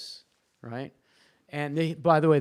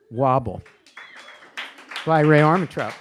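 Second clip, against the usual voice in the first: louder, presenting the book and author; proud announcement; 7 dB louder than usual. Real, not acted. Host announces the award finalist book to the audience, proud announcement. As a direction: announce it loudly for applause.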